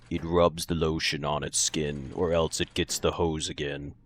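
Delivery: slow, low, softened villain voice; Buffalo Bill. Shown as a demonstration. Streamer quoting a film villain on request, deep slow voice. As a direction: go really deep and slow, keep it quiet. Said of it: "like, a really deep voice".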